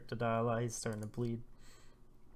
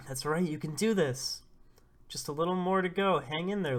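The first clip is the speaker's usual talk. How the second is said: higher and louder, urging her on; urgent encouragement; 5 semitones higher, 6 dB louder than usual. Retold or imitated. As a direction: cheer her through the pain. Helpers coaching Lulu through labor.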